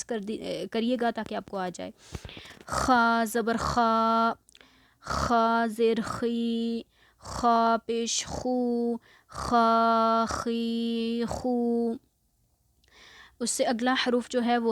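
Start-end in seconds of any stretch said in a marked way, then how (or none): 2.7-12.0 very slow "खा" with a snoring-like rasp in the throat; raspy kha demo. Shown as a demonstration. Teacher slowly demonstrates खा so students can practise. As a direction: scrape खा slowly like a snore. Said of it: "जैसे मैं अदा कर रही हूँ".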